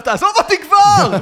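high, loud yell to back off; retold shouting. Runner to a vendor chasing him with an umbrella, shouting. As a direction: shout it as if fending off a pursuer. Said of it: "ואני צועק עליו".

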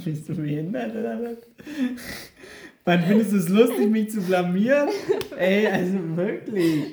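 playfully outraged, laughing at the end; mock outrage. Real, not acted. Mock outrage at trick question.

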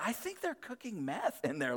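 high, hushed aside; softly acted nosy neighbour; hushed suspicion. Voicing suspicion about the neighbours.

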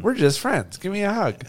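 girly mock voice. Voices waitress friend-zoning him.

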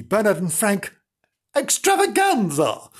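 high, theatrical showman's flourish; hammed-up big title reveal; grand announcement. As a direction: proclaim EXTRAVAGANZA with rising pitch.

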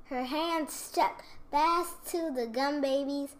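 usual speaking voice

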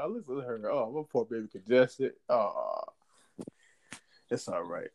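put-on soft, cooing baby talk; tender cooing. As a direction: coo over her gently. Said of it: "I'm like".

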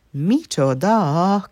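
high, loud, drawn-out outcry; exclaiming. A narrator's reading. Nana objects to the dress turning blue, exclaiming. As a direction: cry out indignantly, stretching the word. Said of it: "kiáltott fel Nana".

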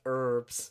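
"herbs" said « erbs » with no h, mock American; H dropped. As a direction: drop the H: "herbs" as « urbs ». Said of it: "You guys missed the H on this word".

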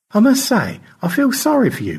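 gracious high thanks; George. Acted. George thanks both rescuers, genteel high voice.

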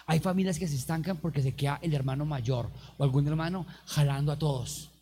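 ordinary delivery

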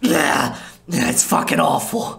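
mild disgusted groan; a touch of revulsion at the rat; lightly voiced weary vampire; Laszlo groaning. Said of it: "and he's just like".

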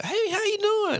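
very high, slow, corny hello; mock his stiff polite greeting. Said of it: "he'd be like".

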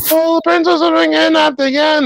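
very high, whiny princess. Retold or imitated. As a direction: sigh it like a damsel. Princess voiced, mock lament.

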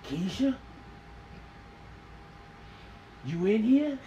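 slow calling out into empty rooms; lightly acted drama call; monologue calling out. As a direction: search the house for Keisha. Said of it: "I'm doing a monologue".

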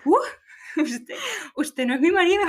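high startled realization; mock shocked mom. Mother realizing the naked man is not her husband, high and startled. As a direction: gasp it out as the embarrassed mother.

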